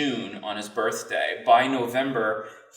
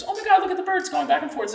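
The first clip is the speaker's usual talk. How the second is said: put-on much higher, fast, thrilled child; excited little girl; faster than usual. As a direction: gush like a small girl seeing birds. Neighbor girl excited about birds.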